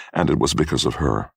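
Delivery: ordinary delivery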